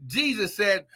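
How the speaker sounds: ordinary talk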